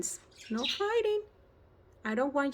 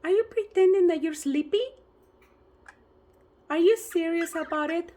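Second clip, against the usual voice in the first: playful pet talk; 5 semitones higher, 5 dB louder than usual. Real, not acted. Teasing the parrot about being sleepy.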